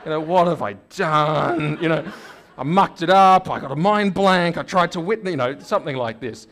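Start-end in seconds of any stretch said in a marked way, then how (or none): 0.0-5.3 louder, higher, flustered self-blame; mock panic. Colleague in the bathroom regretting his blunder, loud mock panic. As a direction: ham up the panic of a botched witness. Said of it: "vision of the guy in the bathroom going".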